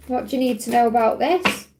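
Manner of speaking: usual speaking voice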